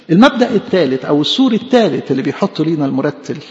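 ordinary delivery

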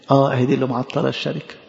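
ordinary talk, nothing marked

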